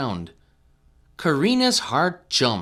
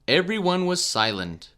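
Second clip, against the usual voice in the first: usual speaking voice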